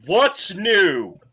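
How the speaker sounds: loud, high shout after someone; yelled retort. Acted. Shopkeeper to the latecomer running away, yelling a retort. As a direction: holler it back, exasperated. [name] yells back in acted exchange.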